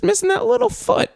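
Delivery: put-on high sing-song baby talk; cutesy high voice. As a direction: coo it like something adorable. Cooing about the little missing foot.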